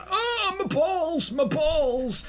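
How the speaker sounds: high, slow, whimpering cry of pain; mock agonized wail. Young Johnny hurts himself training for splits, mock agonized wail. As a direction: wail it in hammed-up agony.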